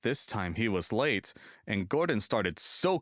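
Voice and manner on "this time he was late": ordinary delivery, nothing marked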